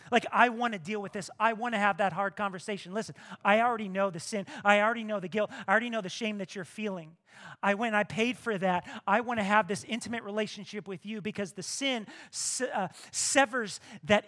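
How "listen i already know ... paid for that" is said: fervent preaching. Acted. Voicing God, fervent.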